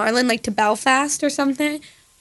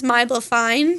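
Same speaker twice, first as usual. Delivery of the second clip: mock Australian vowels; Australian accent. Shown as a demonstration. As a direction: say "Mobile fine" with Australian vowels. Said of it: "Australian is".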